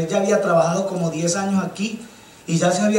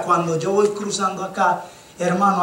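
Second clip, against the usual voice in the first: ordinary delivery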